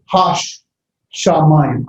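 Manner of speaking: "Hash Shamayim" split, sh ending one part and starting the next; doubled shin. Shown as a demonstration. Teacher showing the dagesh forte, doubled sh in "Hash Shamayim". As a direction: say sh twice in "Hash Shamayim", with a break between. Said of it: "I say the sha, the shin twice".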